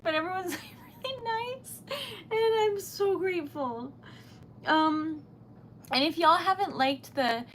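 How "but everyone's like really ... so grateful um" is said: high-pitched, overwhelmed gratitude with giggles; real choked-up, giddy high voice; flustered, near tears. Real, not acted. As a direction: squeak it out, trying not to cry. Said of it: "I'm really properly flustered".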